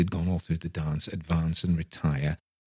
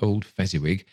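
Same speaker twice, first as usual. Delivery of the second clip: ordinary delivery, nothing marked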